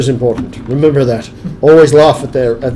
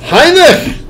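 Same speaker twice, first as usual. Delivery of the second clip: high loud shout of a name; excited shout; 6 dB louder than usual. Real, not acted. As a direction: yell the name out. Calls to friend excitedly.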